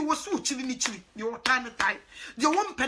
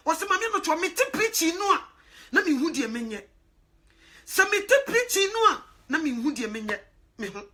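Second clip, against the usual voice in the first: raised, emphatic preaching; fervent sermon delivery; 3 semitones higher, 4 dB louder than usual. Real, not acted. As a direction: preach it out to the congregation. Preaching at full force to listeners.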